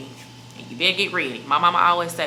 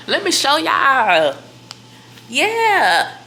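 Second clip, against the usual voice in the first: gasp, then higher, louder and slow; mock excited reveal; 9 semitones higher, 8 dB louder, much slower than usual. Teasing viewers the bump is just food, mock excited. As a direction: play up a big reveal.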